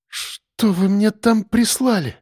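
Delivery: babbling with dread; faltering babble. A narrator's reading. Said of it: "пролепетал Марк". (испуганный лепет, медленно)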